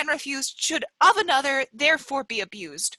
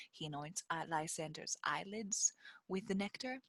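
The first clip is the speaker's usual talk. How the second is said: whispering; 8 semitones lower, 17 dB quieter than usual. A narrator's reading. Whispered stage direction.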